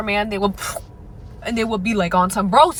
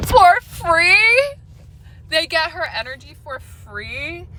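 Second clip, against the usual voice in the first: much higher, louder shocked question; incredulous; 8 semitones higher, 3 dB louder, slower than usual. Real, not acted. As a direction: echo it back in disbelief. Incredulous echo of own point.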